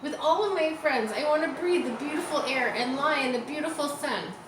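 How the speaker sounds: high, mild weepy plea; a crying piglet; tearful pleading. A narrator's reading. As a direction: wish aloud through tears. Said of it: "Wilbur burst into tears".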